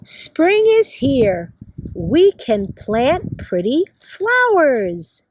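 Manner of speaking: slow, measured picture-book reading; reading to children. Acted. Teacher reads a spring book to kids, slow read-aloud. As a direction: read slowly, each word spaced out for young children.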